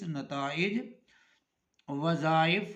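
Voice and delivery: normal talking voice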